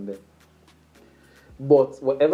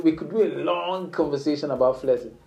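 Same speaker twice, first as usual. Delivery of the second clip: usual speaking voice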